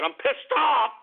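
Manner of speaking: angry outburst jumping in pitch on the last word; pissed off. Real, not acted. Host fuming to listeners over the news, pissed off. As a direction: burst out, insisting you mean it.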